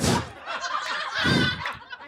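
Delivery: breathless high-pitched giggle; high squeaky giggle. Real, not acted. Comic laughing at her own search list, high squeaky giggle. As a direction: giggle in a tiny airy squeak.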